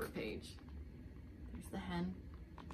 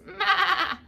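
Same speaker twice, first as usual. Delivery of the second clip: high, loud goat cry; goat bleat; 10 semitones higher, 9 dB louder than usual. Acted. Goat calling from his stall, high loud bleat.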